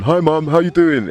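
lightly mocking posh phone hello; mock polite phone voice. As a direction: sing out hello like a good son on the phone. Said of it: "pick up the phone".

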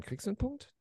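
high, urgent repeat of the question; high pleading question. Real, not acted. (hoch und drängend nachgefragt)

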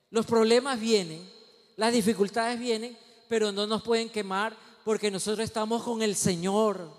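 loud, driving pulpit declaration; emphatic preaching. Real, not acted. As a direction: proclaim it firmly to the congregation. Preaches at full force.

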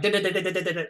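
machine-gun talk. Retold or imitated. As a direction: imitate fast Northern talkers like a machine gun. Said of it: "like a machine gun".

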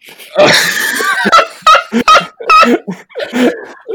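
very high, loud burst of laughter; shrieking laugh. Real, not acted. Laughing at "fireworks go burr.

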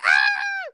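high squealing ah; a piercing excited squeal; excited shriek. Retold or imitated. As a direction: let out a giddy scream. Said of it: "and I was like".

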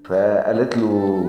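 plain reading voice

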